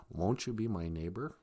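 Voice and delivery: creepy, very low, soft voice; hushed, deep, creepy neighborly invitation; Mister Rogers. Acted. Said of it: "See how creepy his voice is?".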